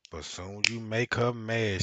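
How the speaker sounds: ordinary talk, nothing marked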